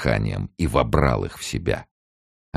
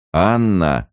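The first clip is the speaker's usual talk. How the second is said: raised, higher warning cry; reproving call; 6 semitones higher, 5 dB louder than usual. A narrator's reading. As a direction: cut off your wife's outburst. Husband reins in his wife.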